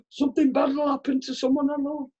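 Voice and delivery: high-pitched mock dread; lightly acted panic; anxious thought. Acting out anxious superstitious thought.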